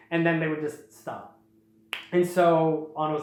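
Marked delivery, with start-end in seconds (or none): none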